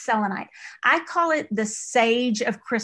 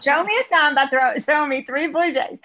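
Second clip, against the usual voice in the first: excited; 4 semitones higher, 5 dB louder than usual. Retold or imitated. Acting out asking for a sign.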